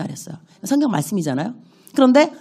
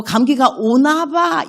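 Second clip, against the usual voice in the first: slow mock complaint; 7 semitones higher, much slower than usual. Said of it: "이런 말들 하지 말라".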